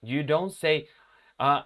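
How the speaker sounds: usual speaking voice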